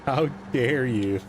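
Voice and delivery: hammed-up theatrical outrage; mock outrage. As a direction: drag out a fake-offended how dare you. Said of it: "best Greta Thunberg voice".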